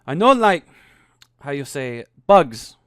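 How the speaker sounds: put-on embarrassed Frenchman hunting for English words in a French accent; thick French accent. Andre, embarrassed, explains his fear in a thick French accent. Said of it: "his French accent thicker now that he was flustered".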